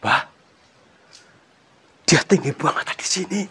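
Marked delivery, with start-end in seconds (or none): none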